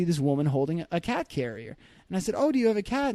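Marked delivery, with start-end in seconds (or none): 2.4-3.1 high-pitched, curious quoted question. Retold or imitated. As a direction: ask it high, friendly and interested. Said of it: "I was just curious about the animal".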